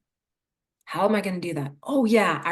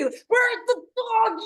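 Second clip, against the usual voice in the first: confused dog owner; 13 semitones higher, much slower than usual. Acted. Owner voiced in puppy analogy.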